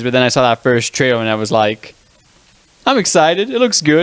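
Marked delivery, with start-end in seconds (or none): 2.8-4.0 higher-pitched replay of a pleased reaction; mildly excited. Retold or imitated. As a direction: lift the pitch, retelling your own excitement. Acts out own reaction to trailer.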